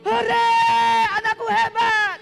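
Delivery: high loud cheer; imagined cheer. Acted. Son imagining father cheering at the TV, loud and high. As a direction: cheer like dad watching on TV.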